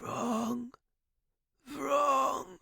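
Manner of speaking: strained high dying croak; hoarse whisper. A narrator's reading. Dying professor to his student, hoarse whisper. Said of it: "I heard a hoarse whisper".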